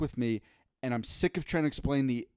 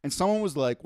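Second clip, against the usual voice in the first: usual speaking voice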